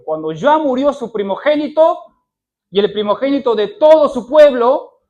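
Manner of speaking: loud rising sermon warning; fiery preaching. Acted. Preacher telling of Pharaoh's firstborn dying, loud and rising. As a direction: thunder it from the pulpit.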